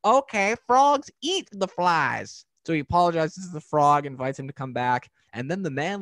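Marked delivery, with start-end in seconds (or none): 0.0-2.5 high, loud, slow dawning realization in the cartoon voice; cartoon man realizing. Cartoon man figuring out that frogs eat flies, high and drawn out. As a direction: let it slowly dawn on the grumpy man.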